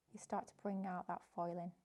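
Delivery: usual speaking voice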